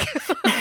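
a short, high, real laugh. Real, not acted. Mom laughs while retelling the bathroom mix-up, high and bright. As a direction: laugh at your own story. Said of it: "laughs at herself".

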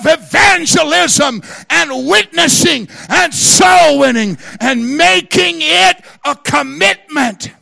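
rally the church to win souls; shouted preaching. Acted.

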